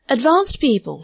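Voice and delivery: a touch of self-important explaining; air of great elucidation. A narrator's reading. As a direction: explain it as if enlightening a pupil. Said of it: "with an air of great elucidation".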